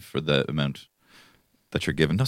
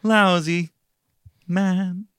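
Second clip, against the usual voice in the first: much higher, very slow crooning Bublé; [name] impression; 11 semitones higher, much slower than usual. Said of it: "I do [name] doing [name]".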